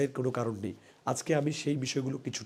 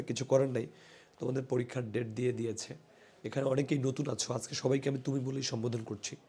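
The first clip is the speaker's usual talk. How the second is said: ordinary talk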